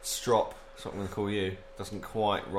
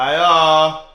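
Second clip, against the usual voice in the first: much louder shouted hello; shouting; 6 semitones higher, 16 dB louder than usual. Real, not acted. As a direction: yell hello across the house. Calling out again, louder.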